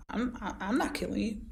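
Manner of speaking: ordinary delivery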